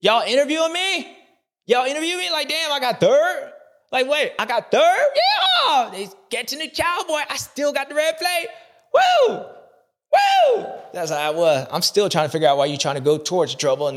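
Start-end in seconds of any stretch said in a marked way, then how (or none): 0.0-8.5 much higher, squealing surprised voice; imitating the Cowboy. Rider learning he got third, high-pitched excited squeal. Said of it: "He was like". 8.9-10.6 high woo cheer. Retold or imitated. Cowboy celebrating.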